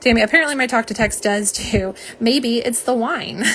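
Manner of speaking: ordinary talk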